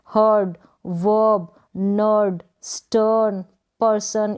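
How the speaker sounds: हर्ड, वर्ब, नर्ड, स्टर्न, पर्सन each slow with a lengthened vowel; long vowel. Shown as a demonstration. Teacher modelling words for practice, long vowels in हर्ड, वर्ब, नर्ड, स्टर्न, पर्सन. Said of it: "वो लंबा होगा".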